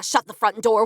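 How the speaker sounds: ordinary delivery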